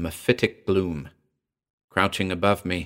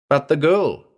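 high, insistent protest; persisting; 8 semitones higher, 4 dB louder than usual. A narrator's reading. Prisoner to captor about the girl, persisting. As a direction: press the objection, pitched up. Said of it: "I persisted".

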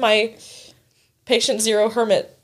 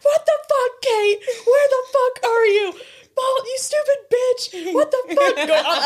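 high-pitched mock drunken yelling; mock screaming; 13 semitones higher, slower than usual. Friend retelling an aggressive FaceTime from drunk friends, mock screaming. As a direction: imitate friends screeching insults over FaceTime. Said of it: "Chad and Javier just screaming".

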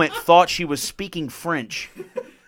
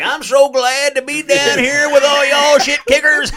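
loud, high, slow Southern drawl; hammed-up Southern politician accent; 9 semitones higher, 8 dB louder, slower than usual. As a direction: lay on a big mock Southern drawl. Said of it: "they suddenly put on a southern accent".

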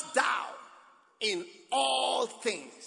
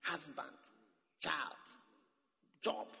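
normal talking voice

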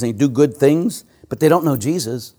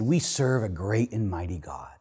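hushed whisper, no voice; 6 semitones lower, 11 dB quieter than usual. Retold or imitated. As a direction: breathe the answer back quietly. Priest answers the toll booth woman.

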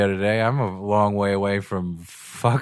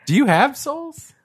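mock incredulous; slower than usual. Teasing question to friend.

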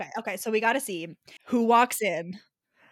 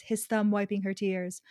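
ordinary talk

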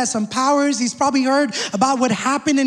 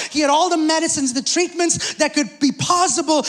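usual speaking voice

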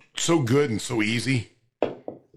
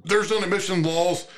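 normal talking voice

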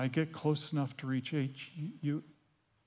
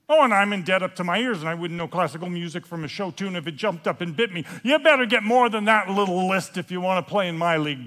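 fast, loud boastful rant; loud one-upping; 2 semitones higher, 7 dB louder, faster than usual. Acted. Heckler at the back outdoing the newcomer, yelling. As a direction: rattle it off loudly to outdo someone. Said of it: "someone in the back of the room yells out".

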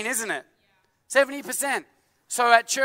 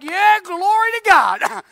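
much higher, louder, slow exultant praise; joyful praise; 10 semitones higher, 9 dB louder, slower than usual. Acted. The tongue rejoices as the latte arrives, exulting. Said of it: "My tongue's gonna be like".

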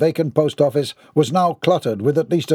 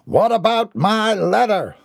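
high, insistent call; calling above chaos; 8 semitones higher than usual. A narrator's reading. Mortimer calls over the children's panic, insistent. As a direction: demand attention, drawing the words out. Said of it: "Mortimer called above the insanity".

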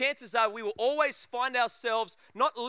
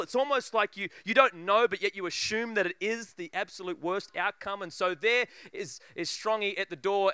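ordinary talk, nothing marked

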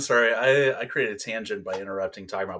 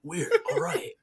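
clueless Keanu impression; 5 semitones higher than usual. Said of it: "just kinda like".

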